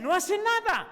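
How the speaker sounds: high scornful jab at the deacons; mock the grumblers, pitched high; mocking complaint. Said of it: "para unos dicen".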